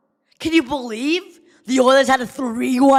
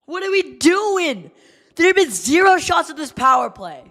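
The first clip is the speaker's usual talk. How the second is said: frustrated shout; 6 semitones higher than usual. Real, not acted. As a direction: vent at the players. Fan shouting at team.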